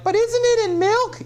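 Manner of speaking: very high squeaky student voice; doubting student. Acted. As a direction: squeak it like a puzzled, objecting pupil.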